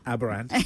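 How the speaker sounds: "Aberrand", Abba and joined by an R; echoed connecting R. Retold or imitated. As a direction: echo "Aberrand" as one linked word. Echoing the connecting R demo.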